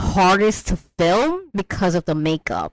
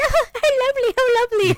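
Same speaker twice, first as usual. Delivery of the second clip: very high-pitched mock cooing; imitated gushing witch; faster than usual. Witch admiring the baby, imitated gushing. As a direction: gush it in a high put-on voice.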